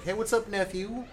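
high, breezy greeting; casual greeting voice. Retold or imitated. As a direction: toss it off high and friendly.

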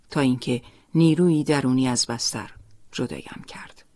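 plain reading voice

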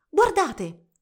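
high, loud, sudden call; excited call. A narrator's reading. Girl showing her friends a wind-up toy, excited. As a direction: draw everyone's eyes to a discovery.

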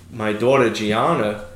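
ordinary talk, nothing marked

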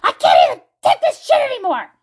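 high-pitched mock shout at a kid; hammed-up furious parent outburst; acted parent yelling. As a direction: yell like a parent at the end of their rope. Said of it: "when you yell at your kid".

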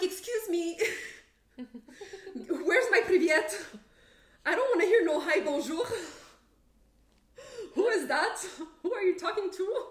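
high, loud, laughing outrage; mock indignant. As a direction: play up your offended protest, laughing through it. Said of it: "I'm like".

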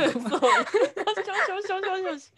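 drawn-out laughing もしもし; laughing mock greeting. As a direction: stretch もしもし slowly while laughing. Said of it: "何ターンもいらないと".

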